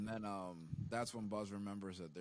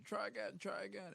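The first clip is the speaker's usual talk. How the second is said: high-pitched egging on; high urging coach; 13 semitones higher than usual. Retold or imitated. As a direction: cheer him on, pitched way up. Urging the shooter repeatedly.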